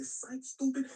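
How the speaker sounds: high, loud gotcha; mock taunt. Advisors double-crossing Caesar, mock taunt. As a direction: crow it as a playful jeer.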